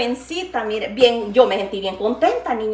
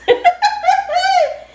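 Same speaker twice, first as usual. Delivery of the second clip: a high, real laugh that bursts out; 5 dB louder than usual. Real, not acted. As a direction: laugh at your own joke, up high. Laughing at her own joke.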